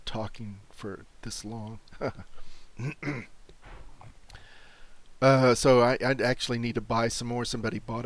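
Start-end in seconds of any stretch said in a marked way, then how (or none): 1.8-5.2 short embarrassed breathy laugh. Real, not acted. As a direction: laugh it off under your breath. Embarrassed after coughing fit.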